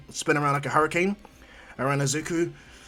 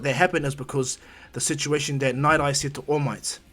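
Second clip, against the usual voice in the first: plain reading voice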